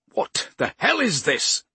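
slow, loud, furious demand; angry shout. A narrator's reading. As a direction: bellow it at him. Gamble confronts Poe furiously.

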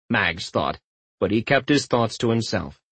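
plain reading voice

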